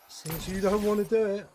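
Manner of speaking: high, loud mock-busy jab; loud teasing. Real, not acted. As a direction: tease him loudly, raising the voice. Teasing friend.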